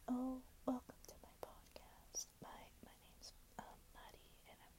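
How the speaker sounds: hushed, whispery podcast intro; barely audible, soft whispered welcome; calm podcast voice. Shown as a demonstration. Host demonstrating a calm welcome to listeners, whispered. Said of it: "a calm voice like this".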